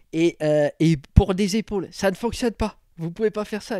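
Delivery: loud, goofy dim-witted voice; dumb character voice. Acted. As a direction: play a dim gym bro. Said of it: "Je deviens un débile mental".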